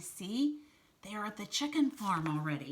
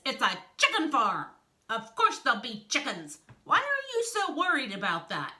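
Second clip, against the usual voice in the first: loud, high exasperated retort; raised voice, climbing in exasperation; 6 semitones higher, 7 dB louder than usual. Acted. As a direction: push back at a silly question. Wolf exasperated by snake's question.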